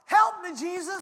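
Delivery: high, loud cry for help; shouting. Acted. Blind beggar crying to passing Jesus, loud cry. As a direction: shout it out to be heard. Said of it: "Or he began to shout".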